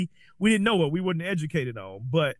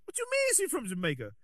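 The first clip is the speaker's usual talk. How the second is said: higher, softer mock confusion; retold disbelief; 8 semitones higher, 5 dB quieter than usual. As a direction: replay your own puzzled question, pitched up. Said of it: "And I'm like".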